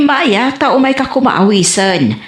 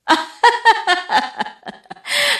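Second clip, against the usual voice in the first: giggle; 13 semitones higher than usual. Acted. Flirting couple, she giggles.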